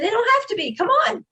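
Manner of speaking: high-pitched, goading comeback; retold defiance. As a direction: challenge him to keep it going. Retelling her defiant answer.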